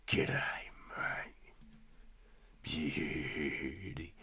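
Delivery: quiet mock Aussie g'day and beauty; Aussie accent. Repeating the Aussie bit quietly to himself in a mock Aussie accent. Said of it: "That was my Aussie impression".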